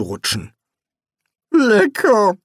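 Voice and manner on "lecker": strained high squeak of a word; forced out. A narrator's reading. Rincewind choking out a word after the porridge, strained. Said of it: "brachte er hervor".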